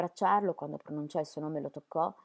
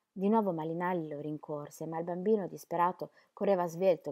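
plain reading voice

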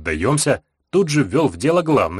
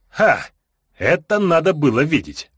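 gleeful exclamation; 5 semitones higher than usual. A narrator's reading.

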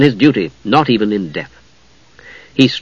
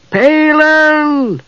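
high, far-off shrill call; kender calling. A narrator's reading. Kender calling from dark room.

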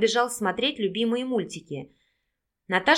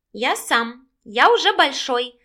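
put-on proud little boy, a touch louder; 4 semitones higher, 5 dB louder, slower than usual. A narrator's reading. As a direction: puff up like a proud small boy. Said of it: "гордо заявил мальчик".

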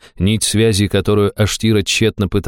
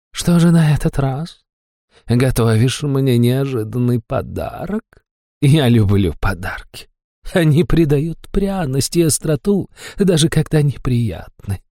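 femme fatale; 5 semitones higher than usual. A narrator's reading. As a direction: purr it like a temptress. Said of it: "промурлыкала суккуб".

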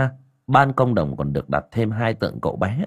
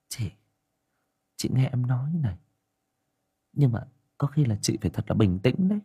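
higher, hushed village aunt voice, speeding up; a younger aunt confiding her fears, quicker as she goes; worried aunt; 4 semitones higher than usual. A narrator's reading. Aunt Liên telling Ngà about the child, worried and hushed.

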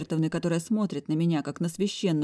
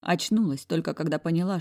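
ordinary delivery

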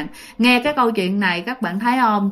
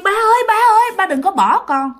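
high, loud cry out to father; high pleading wail, falling at the end; anguished child; 10 semitones higher, 4 dB louder than usual. Acted. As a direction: call after dad in desperate grief. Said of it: "nghe nó rất là đau khổ".

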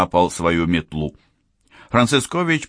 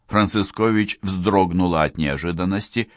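plain reading voice

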